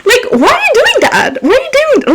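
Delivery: high exasperated mock yell; hammed-up appalled; mock outrage. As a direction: imitate scolding the boss. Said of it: "I would have been like".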